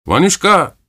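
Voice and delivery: a high, ringing call. A narrator's reading. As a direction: shout to a friend across the sled. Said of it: "diye haykırdı".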